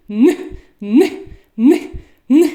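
Н held as a clear, separate nasal sound each time; sound Н drilled. Shown as a demonstration. Teacher drills the sound Н with the class, clearly. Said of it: "Вимовимо зі мною разом Н".

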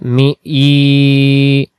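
মি, ই drawn out slowly; long held i sound; stretched vowel. Shown as a demonstration. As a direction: hold the ই in মি for one alif. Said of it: "ইয়ার নিচে খারাজের এক আলিফ".

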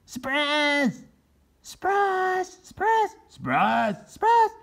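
very high triumphant cries; gleeful yell. Acted. Turkeys springing a trap on the Pilgrims, gleeful. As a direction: shout it to startle the Pilgrims. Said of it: "four turkeys yelled".

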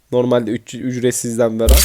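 ordinary talk, nothing marked

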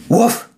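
one sharp high woof to scare mice; quick scaring bark; dog bark. A narrator's reading. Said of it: "with a bark".

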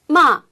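마 falling, loud; fourth tone. Shown as a demonstration. Host repeats fourth tone for scold, loud. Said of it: "사성으로 하면 혼내다".

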